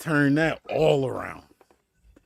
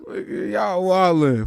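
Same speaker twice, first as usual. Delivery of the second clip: ordinary delivery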